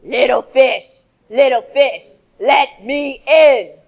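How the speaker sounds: low, loud, slow menacing shark. Shown as a demonstration. Teacher models the shark's demand for the class, menacing. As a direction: growl it like a hungry shark. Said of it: "in your best shark voice".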